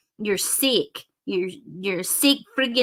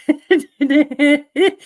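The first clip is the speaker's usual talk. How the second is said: a loud rising laugh; loud cackle; 5 semitones higher, 6 dB louder than usual. Real, not acted. Laughing at twerp.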